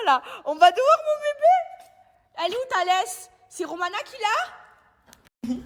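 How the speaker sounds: high, loud sing-song pet talk. Real, not acted. Owner greeting her excited dogs in baby talk. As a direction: coo at the dogs like toddlers.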